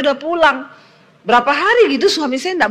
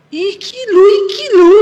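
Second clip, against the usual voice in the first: overwhelmed pointing; 8 semitones higher, 4 dB louder than usual. Retold or imitated. Husband showing the screen, overwhelmed.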